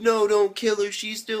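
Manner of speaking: high-pitched mock crying plea; imitated tearful plea. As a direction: plead as if in tears. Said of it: "and she's crying".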